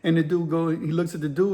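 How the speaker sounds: usual speaking voice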